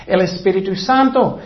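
ordinary talk, nothing marked

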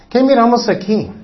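ordinary talk

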